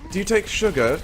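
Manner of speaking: high-pitched mock English accent; hammed-up English politeness; Tracer. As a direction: ask it like a dainty English cartoon. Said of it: "I'm adorably English".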